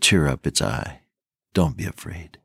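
calm the frightened men gently; Jesus calming the disciples on the sea; gentle reassurance. Acted.

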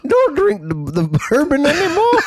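high, laughing, put-upon host scolding a guest; disgruntled host voice. Acted. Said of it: "That's my disgruntled fucking host voice".

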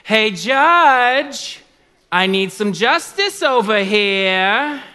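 loud, high, drawn-out holler; widow hollering for justice. Acted. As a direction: call out brashly, stretching the last word. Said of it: "That's how she would say it".

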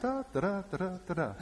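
soft, high-pitched little babble; sing-song to yourself, lost in play; oblivious toddler. Retold or imitated. Toddler playing inside the clothing rack, oblivious babble. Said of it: "just lost in his own little world".

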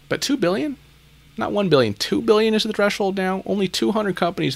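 high, disbelieving question; incredulous. Real, not acted. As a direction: sound genuinely astonished. Incredulous at threshold jump.

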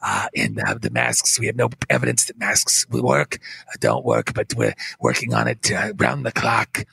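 detached and boring, full of hesitations; low droning official with long uhs; Fauci impression. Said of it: "but he's going".

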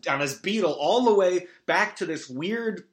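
usual speaking voice

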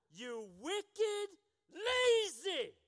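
loud, rising rebuke; angry master. Acted. Master angrily condemning the lazy servant, loud. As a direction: spit out wicked and lazy.

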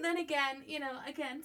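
normal talking voice

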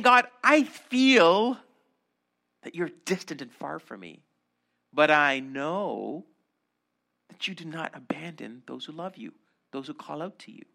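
psalmist praying, tender and trusting. Acted. Voices psalmist's prayer.

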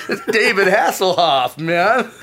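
delighted laughing pride; laughing boast. Real, not acted. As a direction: crow about it through laughter. Boasting about signed photo.

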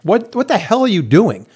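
exasperated homeowner demanding answers; retold angry demand. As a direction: confront the contractor, voice up. Storming over to the contractor.

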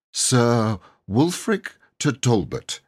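slow, careful words; slowly. Acted. Boy flatters the knight, speaking slowly. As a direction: measure each word out. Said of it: "he said slowly".

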